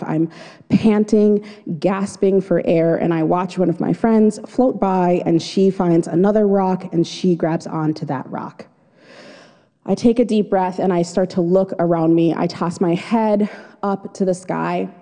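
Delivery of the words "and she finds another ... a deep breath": ordinary delivery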